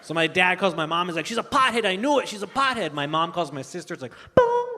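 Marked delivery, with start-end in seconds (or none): 1.3-2.9 agitated dad. Retold or imitated. Dad ranting to mom. 4.4-4.7 high-pitched "bong" chime; imitated paging chime. Comic imitates office intercom before a page, mock chime.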